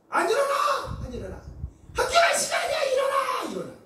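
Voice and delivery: imitated mother's high wake-up yell; wife yelling wake-up. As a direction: shout them out of bed like an exasperated mom. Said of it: "소리를 쳐요".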